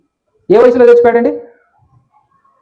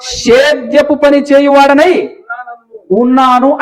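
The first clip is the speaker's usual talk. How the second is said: slow recitation; 4 semitones higher, slower than usual. Shown as a demonstration. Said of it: "అని చెప్పాలట".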